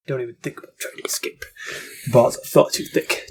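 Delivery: fast whispered movie line; whispered quote. Retold or imitated. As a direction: hiss it like the film villain. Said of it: "in the Princess Bride".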